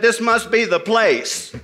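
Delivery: deadpan a dry joke about danger, half laughing; a lightly voiced hunter, amused at the deadly pool; wary hunter's quip. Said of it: "He said".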